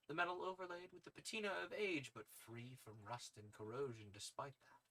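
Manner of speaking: usual speaking voice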